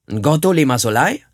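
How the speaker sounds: ruft laut nach der Mutter; fordernder, lauter Ruf vor der Hütte. Acted. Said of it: "rief er". (Krieger ruft vor der Hütte nach der Mutter, laut)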